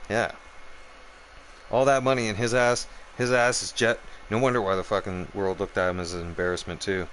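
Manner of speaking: normal talking voice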